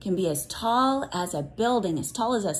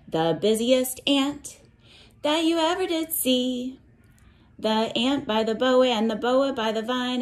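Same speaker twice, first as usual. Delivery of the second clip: put-on reading to children; 4 semitones higher than usual. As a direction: chant it like a picture book for little ones. Teacher reads cumulative rainforest book.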